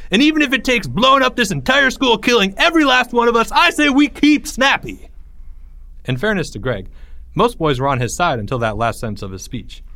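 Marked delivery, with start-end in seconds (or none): 0.1-5.1 high, loud, overblown climax; fervent rallying cry. A narrator's reading. Greg ends his speech from the bench, fervent. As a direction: whip the crowd up with a zealot's fervor.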